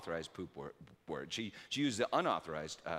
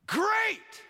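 much higher, loud, hammed-up cheer; mock enthusiasm; 9 dB louder than usual. Dad to his daughter, a hammed-up enthusiastic much higher shout. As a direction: fake delight, thinking of a nap. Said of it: "I looked at her and I said".

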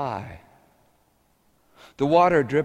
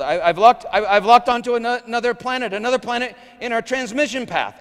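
higher, louder panicked technician call; yelled alarm; 11 semitones higher, 7 dB louder than usual. Retold or imitated. Technician yelling to the ride crowd, higher and louder. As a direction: sound the alarm, rushed and high. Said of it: "one of the technicians yelled".

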